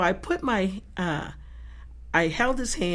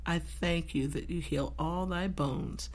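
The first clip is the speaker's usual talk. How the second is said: soft, slow prayer; 8 dB quieter than usual. Retold or imitated. Praying over the arm.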